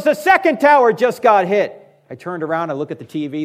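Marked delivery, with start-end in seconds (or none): 0.0-1.8 higher, louder alarmed shout; retold scream. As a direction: shout it down the phone in shock. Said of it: "he suddenly screams into the phone".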